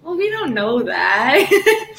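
slow, laughing doubt; stretched-out, chuckling disbelief; laughing caution. Retold or imitated. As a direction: hold back the joy with a laugh. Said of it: "And I was like".

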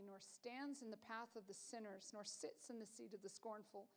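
quiet, measured reading of the psalm; scripture reading. Acted. Pastor reading Psalm one to the congregation, soft and measured. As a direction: read the verses softly and reverently.

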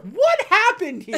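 high, loud and slow through laughter; laughing mock outrage. Riza gasps at the betrayal, laughing high and slow. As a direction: giggle out a baffled demand. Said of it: "And riza's like".

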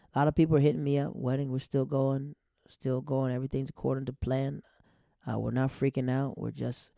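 ordinary talk, nothing marked

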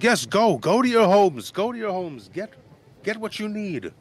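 high, loud mayor shooing people home; the mayor sends the villagers home to pack; hurried mayor. Acted. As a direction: hurry everyone off.

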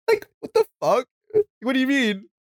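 high-pitched outraged what the fuck; climbing indignation at a friend who invited you; heated disbelief. Real, not acted. Venting about flaky friend's invite.

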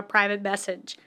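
ordinary talk

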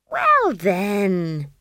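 drawn-out triumphant opener. A narrator's reading. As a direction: stretch it out in triumph. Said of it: "said Esk triumphantly".

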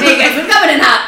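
big, loud, laughing call-out; mock shouted announcement. Podcaster hollers to the guys that they're arriving, mock shout. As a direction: shout it out loudly through laughter.

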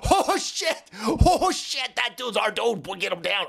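high-pitched "oh shit" of sudden realisation; mock alarm. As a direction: act out a panicked oops, pitch leaping up then settling. Acting agents' panicked reaction to wanted man.

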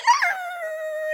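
long, very high imitation whine; crying dog whine. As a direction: whine like a dog begging to play.

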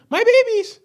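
very high, loud cartoon wail; mock-distraught cartoon mother crying out; scorpion's cry. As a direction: imitate a shrieking cartoon scorpion. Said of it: "the scorpion was like".

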